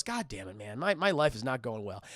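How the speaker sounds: low, soft sulky grumbling; resentful grumble. Acted. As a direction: grumble bitterly to yourself, way down low. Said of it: "Barney's just fucking resentful".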